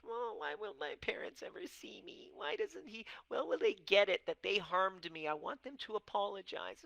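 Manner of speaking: high, breathy whine to half whisper; whiny complaining child. Retold or imitated. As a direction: plead like a hurt kid wanting an apology. Voicing our own whiny complaint.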